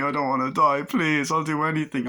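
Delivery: a mock panicked plea; pleading for life. Boss begging for his life.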